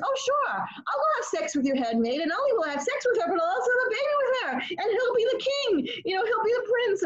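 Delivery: high, fast, glib sarcastic voice; mock his careless eager agreement; sarcastic impression of a shallow husband; mocking Abraham.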